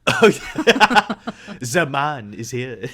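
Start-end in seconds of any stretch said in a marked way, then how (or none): 1.6-2.9 put-on slow French-accented narrator; French announcer from SpongeBob. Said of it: "the French announcer from SpongeBob".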